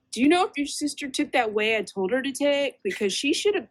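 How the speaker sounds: lightly voiced fussy dad; a hint of a worried father; imitating Dad. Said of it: "Dad called me".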